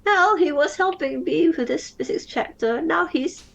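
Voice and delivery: put-on high, frustrated young woman; upset girl voice. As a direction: sputter it out, distressed. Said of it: "She tries again".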